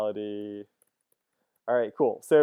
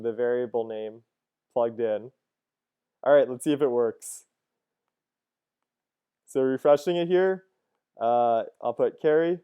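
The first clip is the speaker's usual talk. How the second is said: usual speaking voice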